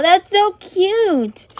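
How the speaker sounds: ordinary talk, nothing marked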